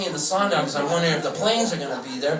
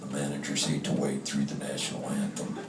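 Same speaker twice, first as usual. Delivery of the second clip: lowered gruff manager voice; low, clipped baseball manager; imitating the manager with a lower voice; mock Bruce Bochy; 10 dB quieter than usual. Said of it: "always said to me".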